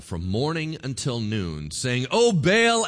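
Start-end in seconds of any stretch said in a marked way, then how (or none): 2.1-2.9 high, loud appeal to a god; acted calling out. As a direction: cry out as a pleading worshipper. Acting out prophets calling on Baal.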